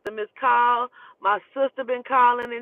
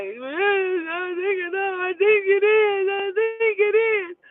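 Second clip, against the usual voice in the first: high, drawn-out mock wail; imitated crying; 11 semitones higher, slower than usual. Friend's mom crying over the news, imitated wailing. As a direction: wail it slowly through sobs, imitating. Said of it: "crying, like".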